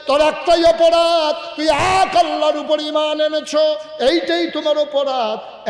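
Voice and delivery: climactic proclamation. Acted. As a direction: proclaim it at full force. Prophet's climactic declaration.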